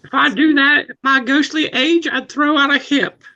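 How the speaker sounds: put-on high creaky old ghost; old man voice. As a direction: grumble like an aged ghost.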